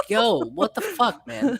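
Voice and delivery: lightly voiced annoyed cat, high and slow; Milo, pissed. Speaking for the locked-out cat, mock pissed. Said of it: "so Milo is pissed".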